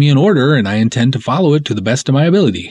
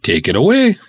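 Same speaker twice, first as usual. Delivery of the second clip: normal talking voice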